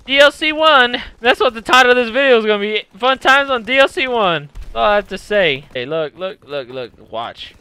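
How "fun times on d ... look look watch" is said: ordinary talk, nothing marked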